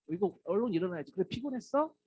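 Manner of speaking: high, light, caring tone; tender wake-up. Shown as a demonstration. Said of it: "하는 그런 목소리로 들으시면". (피곤한 아이에게 다정히 묻는 목소리)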